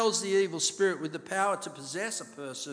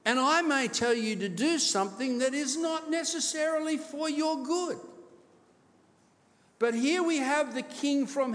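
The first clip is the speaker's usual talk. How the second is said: impassioned preaching; 7 semitones higher than usual. Acted. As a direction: build the appeal to a peak on you. Preaching builds to full force.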